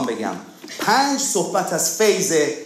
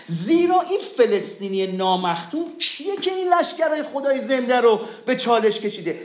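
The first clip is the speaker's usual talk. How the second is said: raised, ringing defiant question; David's bold challenge; 7 semitones higher than usual. Retold or imitated. Preacher voicing David to the fearful army, higher defiant cry. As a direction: fling the challenge with faith. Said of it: "جالبه داوود میگه".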